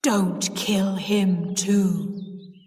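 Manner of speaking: very slow, drawn-out ghostly command; deep voice. A narrator's reading. Niece speaking into the rain pipe, deep voice. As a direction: draw out each word like a voice from the grave. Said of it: "in a deep voice".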